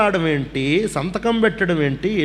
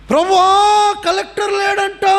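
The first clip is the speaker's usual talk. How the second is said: high, loud mock child's wail; children wailing; 5 dB louder, slower than usual. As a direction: imitate little kids crying out to the Lord. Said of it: "అని ఏడుస్తున్నారు". (చిన్న పిల్లల ఏడుపు అనుకరణ, పెద్దగా, ఎత్తైన స్వరంలో)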